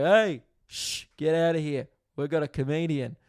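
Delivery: hammed-up thrill at a comedian; excited neighbour. Neighbour shooing the doctor away, high and excited. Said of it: "he was just like".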